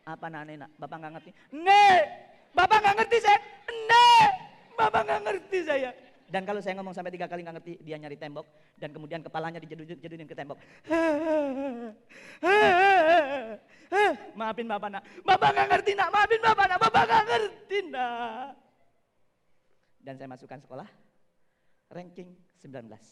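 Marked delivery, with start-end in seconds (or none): 1.5-5.9 put-on loud, high, insistent child's cry; a small boy yelling at his dad who won't understand; frustrated child shouting. Frustrated son yelling at his father, loud and high. 10.9-13.6 choked sob-laugh. Real, not acted. Father overcome remembering son. 14.4-18.6 rising, louder, breaking into tears; pleading through tears to his son; tearful apology. Real, not acted. Father begging his son's forgiveness, voice breaking into tears.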